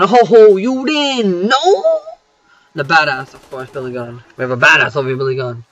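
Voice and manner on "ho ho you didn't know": high, slow teasing put-on voice; mock taunt. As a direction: drag out a mocking ho ho, much higher.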